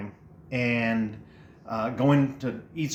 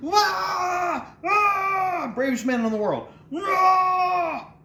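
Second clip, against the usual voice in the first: high, mock-crying screams of a scared kid; a child's high, crying screams at night; retold terrified screaming; much slower than usual. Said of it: "'cause I'm still screaming".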